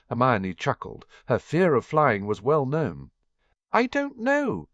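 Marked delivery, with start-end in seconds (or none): 3.7-4.7 playful tease, pitched much higher; teasing. Acted. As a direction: toss it back at him with a sly lilt. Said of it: "she teased".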